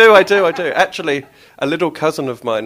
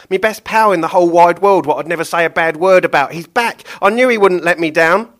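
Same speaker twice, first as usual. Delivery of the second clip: much higher, loud gleeful butcher; delighted Billy; 6 semitones higher, 10 dB louder than usual. Acted. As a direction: gush over your best pal. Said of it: "the horrible butcher, Billy William the third".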